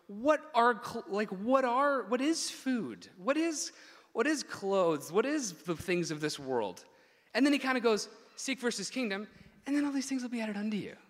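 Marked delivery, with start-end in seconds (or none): 0.1-6.8 high, loud, emphatic questioning; rhetorical challenge. Retold or imitated. Preacher voicing Jesus questioning what food and clothes are, emphatic challenge. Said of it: "Jesus spent the previous eight verses going". 8.4-11.0 high, with the promise tossed off quickly; offhand tone. Shown as a demonstration. Preacher showing the tone Jesus puts on the verse, offhand promise. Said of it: "there's a tone that needs to be put on this verse".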